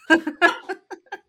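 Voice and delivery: bursting out laughing high; a bright burst of laughter. Real, not acted. Laughing at protractor joke.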